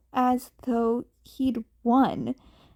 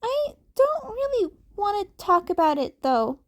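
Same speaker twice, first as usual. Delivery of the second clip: put-on reluctant kid Deku; 9 semitones higher than usual. A narrator's reading. Cat kid refusing to talk, high and reluctant. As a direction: dodge the topic.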